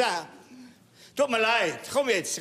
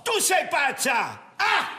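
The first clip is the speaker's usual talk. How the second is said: shouting; 7 semitones higher, 3 dB louder, slower than usual. Acted. Shouting back in marital quarrel.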